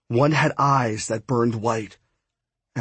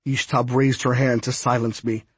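ordinary delivery

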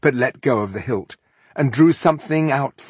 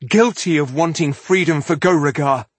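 raised, louder declaration; defiant interruption; 7 semitones higher, 6 dB louder than usual. A narrator's reading. Rebel woman interrupting a confession, defiant. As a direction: cut in and proclaim it. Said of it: "interrupted Malenia".

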